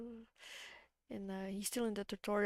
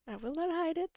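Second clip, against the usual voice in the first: usual speaking voice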